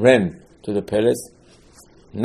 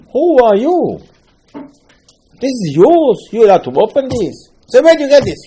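much higher and louder, slow indignant rebuke; 11 semitones higher, 7 dB louder, slower than usual. Retold or imitated. Hashem rebukes Chizkiyahu for opening the Ark, indignant high cry. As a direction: demand it like a scandalised master.

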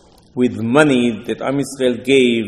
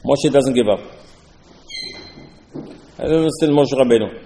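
ordinary delivery

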